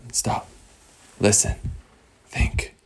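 softly, one word at a time with pauses; hushed alert. Acted. Island explorers listening for a trickling stream, hushed. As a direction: hush the group to listen.

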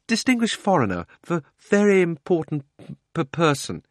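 high, nervous stammer. A narrator's reading. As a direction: stumble over the words in fear. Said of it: "he stammered".

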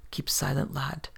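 whispered urgent warning; whispered, keeping the boy silent; hushed whisper. A narrator's reading. Narrator hushing boy in danger.

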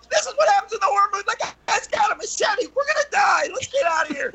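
very high, rapid mock-hysterical squeal; screaming girl. Terrified girl to her friends in tunnel, mock hysteria. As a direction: imitate a girl shrieking in panic. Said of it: "And girls screaming".

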